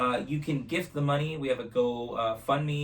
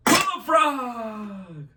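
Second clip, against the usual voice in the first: long, high shouted chant; shouting magic words; 11 semitones higher, 8 dB louder, much slower than usual. Real, not acted. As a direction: call it out to the crowd.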